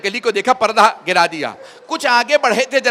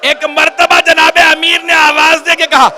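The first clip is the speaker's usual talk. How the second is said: loud, high, wailing preacher's lament; rising grief-stricken sermon, loud and slow; majlis recitation at full force; 9 semitones higher, 6 dB louder than usual. Acted. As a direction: cry the lament out to the mourners.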